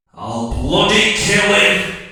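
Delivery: put-on loud, slow furious old woman's outburst; grandma's outburst. Grandma shouting across a silent theatre, loud and slow. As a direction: blurt out a threat far too loud. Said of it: "Jodie's grandma goes".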